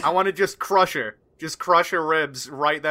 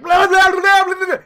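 loud, high frantic babbling; wild rattled babble, acting out shaking; shaking noise; 12 semitones higher, 7 dB louder than usual. Player mimics Howland shaking her, loud frantic babble. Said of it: "Just shaking her like".

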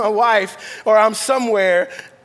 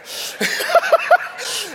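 bursting high laugh; 13 semitones higher than usual. Real, not acted. Laughs after wife quote.

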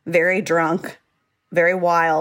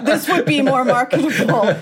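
mock publisher; 6 semitones higher, 4 dB louder than usual. Joining the publisher parody.

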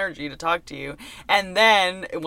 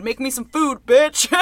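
raised, louder mock demand; hammed-up bossy outburst, punching the last word; mock ragey; 7 semitones higher, 6 dB louder than usual. Cameraman goofing around filming the hallways, mock ragey. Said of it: "weirdly ragey at points".

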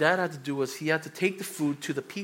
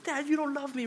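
much higher, mock whiny teenager; whiny teen; 10 semitones higher than usual. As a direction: pitch it up like a sulking kid. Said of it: "A typical high schooler".

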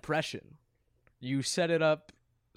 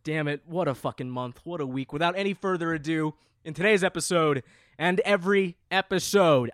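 usual speaking voice

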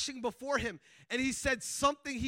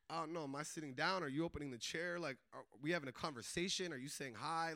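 low, softer acted-out uncertainty; awkward; 7 semitones lower, 9 dB quieter than usual. Sitting down with a stranger at the hotel, awkward. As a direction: mumble through an awkward little exchange in your head. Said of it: "weird, like, awkward, like".

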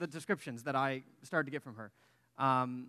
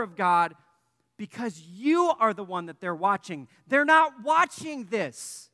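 higher, louder, slow impassioned preaching; preaching at full force; 7 semitones higher, 9 dB louder than usual. Acted. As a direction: drive the point home to the congregation. Sermon climax.

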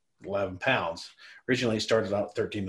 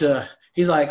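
normal talking voice

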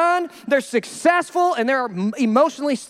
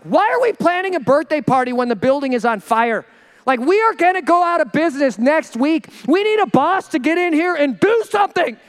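louder, acted workplace frustration; mock-rant at a boss who won't act; exasperated employee; 3 dB louder than usual. Employee fed up with soft boss.

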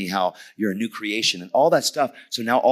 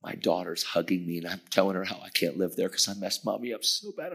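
usual speaking voice

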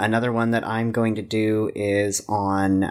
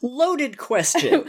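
slow, high laughing words; 13 semitones higher, much slower than usual. Real, not acted. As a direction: laugh it out slowly. Laughing at the absurd question.

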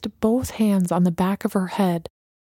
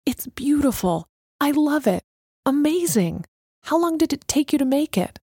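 higher-pitched, delighted gushing; exclaimed admiration; 6 semitones higher than usual. A narrator's reading. Alana admires Messenger's incredible creation, exclaimed with delight. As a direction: gush with amazement, pitched up. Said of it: "she finally exclaimed".

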